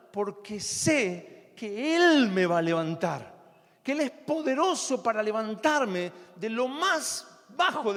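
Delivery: loud, high-pitched pulpit exhortation; fiery preaching. Acted. Preacher urges the congregation loudly to examine their failings. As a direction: preach it with rising fervor.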